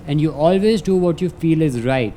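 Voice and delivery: ordinary talk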